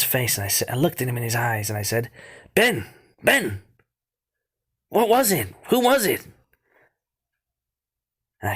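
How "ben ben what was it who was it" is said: high and loud, pleading for an answer; desperate pleading. Retold or imitated. Boy shaking his brother for an answer, high desperate pleading. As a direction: grab him and beg him to answer.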